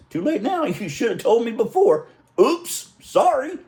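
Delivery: high, laughing mock apology; playful mock oops sorry, high and laughing; cheeky. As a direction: tease him with a fake sorry. Cheeky reply to uncle.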